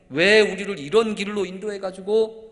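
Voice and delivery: loud, high-pitched shouted protest; building from indignant grumble to yelling accusation; angry, resentful complaint. Acted. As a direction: cry out like disciples on a sinking boat. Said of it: "한층 화가 났어요. 불평도 하고 원망이".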